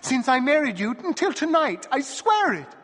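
high, loud, desperate; tearful pleading. A narrator's reading. Wife swearing her innocence to the dwarf, high and tearful. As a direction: swear it with tears.

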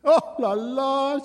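high, slow mock-coquettish cry; bride crying out. A narrator's reading. Bride gleefully teasing her dwarf husband, high-pitched cry. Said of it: "she cried".

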